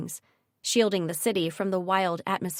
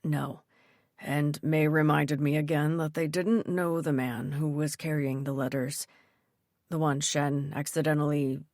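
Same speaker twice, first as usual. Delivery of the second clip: father voice; 4 semitones lower than usual. Acted. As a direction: voice the calm father, low. Narrator voices Dad.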